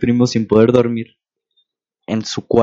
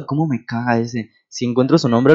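ordinary talk

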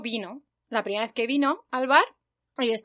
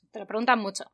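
usual speaking voice

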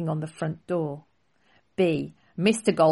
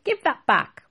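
higher, louder shopkeeper call; calling after; 8 semitones higher, 5 dB louder than usual. Acted. Melon seller shouting at the prince, loud. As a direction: demand it back across the street.

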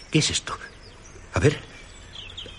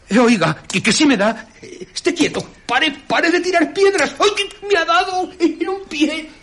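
high, frantic yelps that climb higher and higher; rising panicked cries. Acted. Vagabond pelted by invisible stones, crying out higher in pain. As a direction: beg him to stop as the stones keep hitting.

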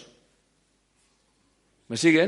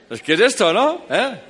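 laughing; 10 semitones higher, 7 dB louder than usual. Real, not acted. Keeps laughing over the ham joke.

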